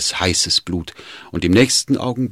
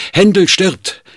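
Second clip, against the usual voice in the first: high, loud urgent cry; shouting; 14 semitones higher, 9 dB louder than usual. Acted. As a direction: call out in alarm. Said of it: "rief er dem Herzog zu".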